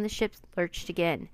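ordinary delivery, nothing marked

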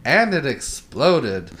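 slow, soft deadpan; deadpan imitation. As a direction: drop it flat and slow. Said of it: "the way he says it".

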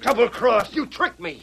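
high, shocked realization; the floor drops out; stunned dismay. Acted. Villain realizes he's trapped.

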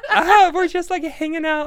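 high, giggly, flustered; nervous giggling; laughing. Retold or imitated. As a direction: laugh it off in front of the crush. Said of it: "And I'm like".

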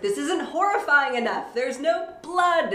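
mock complaint. Writer fretting over a story halfway through, mock dismay. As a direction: act out an over-the-top worry. Voicing own worry about story.

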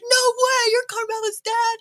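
very high imitated disbelief; amazed bystanders. Strangers realizing who her dad is, high imitated amazement. Crowd's amazed reply, retold.

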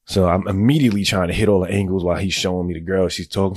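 normal talking voice

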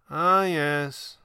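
put-on wistful old magician; sad. A narrator's reading. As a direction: sigh it out sadly. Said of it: "the stranger said sadly".

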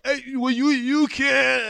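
high, tearful voice, half joking; playful tearful pleading; mock choked-up crying. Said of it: "And I'm a cry".

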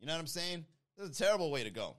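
ordinary talk, nothing marked

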